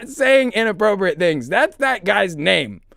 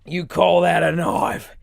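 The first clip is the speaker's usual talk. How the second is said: Crocodile Dundee quote; 3 semitones lower than usual. Retold or imitated. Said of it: "maybe it's Crocodile Dundee".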